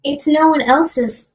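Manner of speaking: ordinary delivery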